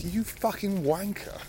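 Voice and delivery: slow, high, put-on bloke's insult; drawn-out mocking of the angry bloke; mock shouted insult. Retelling the bloke's insult on the path, mock shout. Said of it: "this bloke gets in my face as I go past and shouts".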